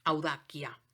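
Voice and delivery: audacia said with a hard K, au-DA-kia; careful Latin "audacia" with K sound; Latin pronunciation. Shown as a demonstration. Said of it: "la C suena K".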